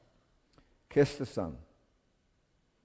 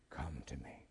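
soft, much lower, breathless whisper; whispered invitation; 12 semitones lower, 19 dB quieter than usual. Acted. Jesus calling the listener close, in a hushed whisper. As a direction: whisper it like a secret call.

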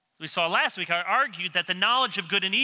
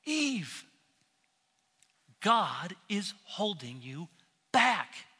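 a lightly voiced tempter, softer and drawn out; sly Satan; 3 dB quieter, slower than usual. Satan whispering doubt to Eve, softly coaxing. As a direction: coax her like a hushed serpent. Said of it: "what Satan is saying".

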